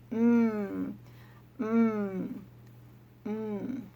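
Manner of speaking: hummed "Mmm" like tasting cheese; yummy mmm. Shown as a demonstration. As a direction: hum "Mmm" as if nibbling cheese. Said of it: "she nibbles it and she says".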